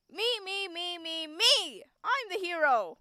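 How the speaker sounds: high, loud brash hero boast; a self-obsessed hero crowing me me me; America from Hetalia. Acted. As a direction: play it like a cocky anime hero. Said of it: "He just sounded like America".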